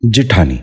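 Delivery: جٹھانی said "ji-thaani", with i after the j; short i in the first syllable of جٹھانی; correct pronunciation. Shown as a demonstration. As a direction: say جٹھانی as "ji-THAA-ni". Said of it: "دوبارہ سنیے".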